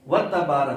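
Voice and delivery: وَتَبَارَ with وا run into a doubled تا, "watta"; fuse the first two syllables of وَتَبَارَ as watta; joined syllables. Shown as a demonstration. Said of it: "وَتَّا نہیں ہے". (وَتَبَارَ، واو کو تا سے ملا کر)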